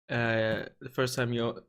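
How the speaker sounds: ordinary talk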